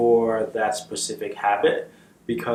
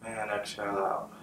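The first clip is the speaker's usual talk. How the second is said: calm, unhurried meditation guide with long pauses; measured, settled voice for leading meditation; guided meditation. Real, not acted. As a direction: guide them gently into the breath, leaving space after each phrase.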